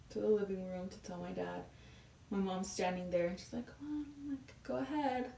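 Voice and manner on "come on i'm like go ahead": high, soft mom imitation; hushed urging. Mom prodding daughter to tell dad, hushed urging. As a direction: nudge her on under your breath, pitched up.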